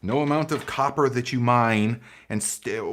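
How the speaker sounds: normal talking voice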